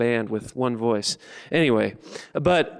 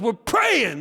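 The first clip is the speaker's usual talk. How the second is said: high, raised character voice; protesting shout; 11 semitones higher than usual. Acted. George answers the big guys outside the car, high protesting shout. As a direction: shout back in indignant explanation.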